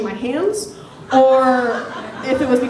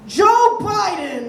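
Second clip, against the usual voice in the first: put-on drawn-out slurred drunk yell; drunk shout; 7 semitones higher, much slower than usual. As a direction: stretch it out like a proud drunk. Drunk guy answers president question.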